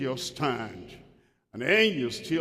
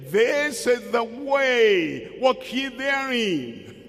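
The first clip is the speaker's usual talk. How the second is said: higher, louder, slow pulpit pronouncement; mock proclamation; 6 semitones higher, 3 dB louder, slower than usual. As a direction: declare it like a pastor laying down the law. Pastor quoted proclaiming to members.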